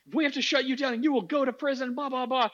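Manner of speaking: high-pitched stern imitation, not really shouting; mock angry leader. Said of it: "the next time she was very angry".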